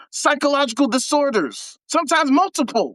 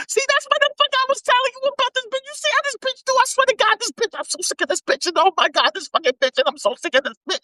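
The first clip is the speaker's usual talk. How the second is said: mock the uncle's whiny rant, pitched way up; high-pitched imitation of a complaining man; high mocking whine; 9 semitones higher, faster than usual. Mocking uncle's rant about girlfriend.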